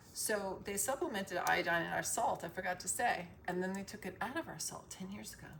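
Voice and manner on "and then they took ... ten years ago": ordinary talk, nothing marked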